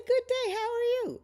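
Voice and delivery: high-pitched, loud hello back; cheery greeting. Retold or imitated. Judge answering a fan in the checkout line, cheery greeting. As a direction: sing out a bright retold reply. Voices her own cheery reply.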